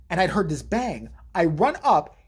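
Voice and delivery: normal talking voice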